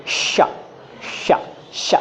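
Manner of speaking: श repeated as long, separate hushes; isolated SH. Shown as a demonstration. Teacher letting students feel the tongue change, isolated SH. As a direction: say श alone, hushing, with pauses. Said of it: "जब हम श बोलते हैं".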